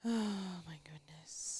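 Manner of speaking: breath-only, very soft; hushed, unvoiced exclamation; whispered sigh. Real, not acted. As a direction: sigh it out under your breath. Sighing reaction under her breath.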